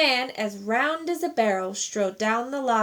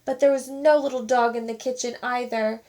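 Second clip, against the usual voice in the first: plain reading voice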